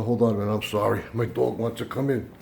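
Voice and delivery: ordinary delivery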